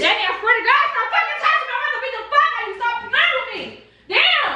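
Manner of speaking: high-pitched, exasperated threat; real anger. Real, not acted. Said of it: "You ain't that mad".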